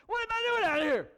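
high, loud panicked yell; hammed-up frightened shout; acted sudden panic. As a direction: shriek it as alarm hits. Peter panics on the waves.

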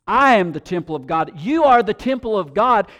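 preacher declaring to the church, rising; declaration. Acted. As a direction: proclaim it as your own identity. Congregation declaration.

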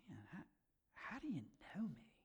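hushed, breathless whisper of amazement; awed whisper. Acted. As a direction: whisper it as if stunned.

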